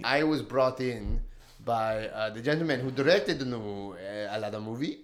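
low, soft, hushed mock-serious voice; mock deep voice. Answering the genie question in a deep mock voice. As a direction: play it low and grave like a voice actor.

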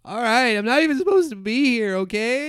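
loud, high-pitched protest; flustered outburst. Acted. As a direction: blurt it out in a panicky shout. Flustered doctor protests to upset patient.

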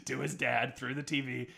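normal talking voice

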